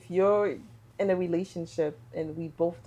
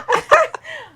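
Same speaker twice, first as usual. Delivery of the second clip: high, loud burst of laughter; 12 dB louder than usual. Real, not acted. Laughing at nanny correction.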